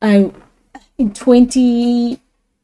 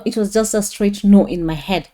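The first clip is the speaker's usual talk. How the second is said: usual speaking voice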